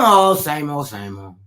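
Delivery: a hint of a bored woman; glum reply. Imitating a bored woman answering her friend. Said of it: "one of those women that says".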